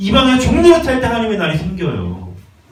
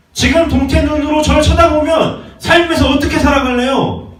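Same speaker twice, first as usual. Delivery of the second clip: raised, loud rebuke to the pews; scolding shout; 8 semitones higher, 3 dB louder than usual. Real, not acted. Pastor shouting at a dull-eyed congregation, rebuking. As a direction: shout the challenge at sleepy listeners.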